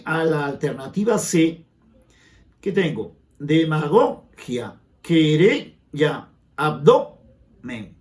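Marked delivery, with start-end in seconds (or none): 3.4-8.0 "Demagogia", "querella", "abdomen" slowly, each split after GO, RE, DO; slow demo of "Demagogia", "querella", "abdomen", next-to-last syllable hit; stress on penultimate syllables. Shown as a demonstration. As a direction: punch dema-GO-gia, que-RE-lla, ab-DO-men, pausing before each ending. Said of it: "palabras graves o llanas".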